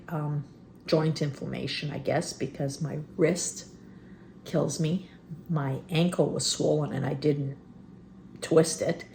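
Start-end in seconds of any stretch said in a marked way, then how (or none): none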